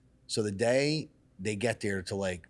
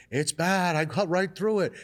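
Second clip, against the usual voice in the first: high, loud frantic cry; retold panicked old plumber; 10 semitones higher, 5 dB louder than usual. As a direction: voice him in a panic. Plumber panicking over gas leak.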